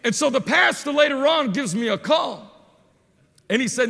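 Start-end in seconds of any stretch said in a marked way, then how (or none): none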